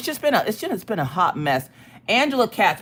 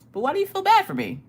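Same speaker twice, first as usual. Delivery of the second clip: mock offended; 13 semitones higher, faster than usual.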